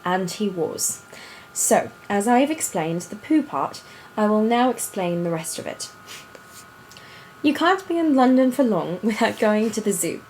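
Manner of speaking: put-on storybook reading in an affected British accent. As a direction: read it aloud like a British storyteller. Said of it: "do it in that accent".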